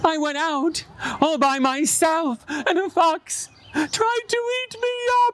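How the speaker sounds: very high hammed-up wailing; mock crying. Little Rabbit confessing to Mama, crying high. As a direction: wail like a sorry little bunny.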